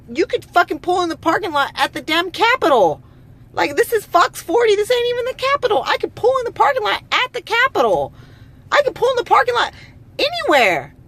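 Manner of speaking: very high-pitched, exasperated protest; indignant rant. Real, not acted. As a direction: argue in outraged disbelief, voice way up high. Ranting about being barred from parking.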